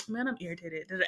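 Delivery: low, quiet offhand mumble; mock sly, softly tossed-off complaint. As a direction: slip it out under your breath, imitating a friend. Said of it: "you say it very on the sly".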